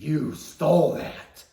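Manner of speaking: loud, high, slowed accusing shout; furious mom. Retold or imitated. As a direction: drag it out, every word an accusation.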